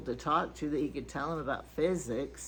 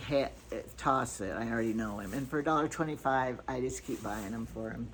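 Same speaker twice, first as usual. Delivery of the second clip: usual speaking voice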